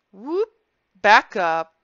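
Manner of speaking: stop, then laugh it off in disbelief; startled. Real, not acted. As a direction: pull up short, amazed. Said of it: "I'm like".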